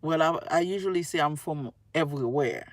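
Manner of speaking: usual speaking voice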